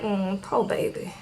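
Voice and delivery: a lightly voiced sympathetic waitress; pitying waitress. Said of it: "the waitress is like".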